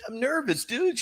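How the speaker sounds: high-pitched, lightly hammed-up nerves; mock nervous protest. Retold protest of his own nerves.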